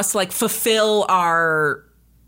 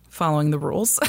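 normal talking voice

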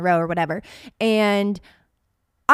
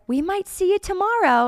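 mock doctor; 10 semitones higher than usual. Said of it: "the one doctor's like".

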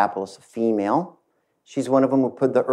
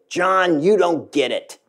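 raised, higher pitched angry accusation; a co-founder confronting the new CEO, angry and raised; angry outburst; 5 semitones higher than usual. Retold or imitated. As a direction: jab the words at him, heated. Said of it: "got up quite angry".

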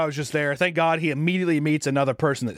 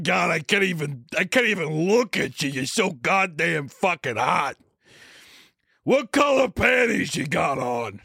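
Vin Diesel creep; 2 semitones higher, slower than usual. Retold or imitated. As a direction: bellow it slowly like a shameless flirt. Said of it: "He's like".

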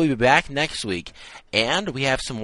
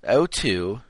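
put-on British accent; 3 semitones higher, 3 dB louder than usual. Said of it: "said that in a British accent".

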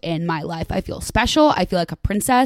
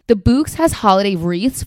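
ordinary delivery

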